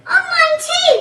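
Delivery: high, loud exclamation; shouted. A narrator's reading. Mister Twit discovering droppings on his head too, shouted. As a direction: shout it out in alarm. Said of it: "shouted Mister Twit".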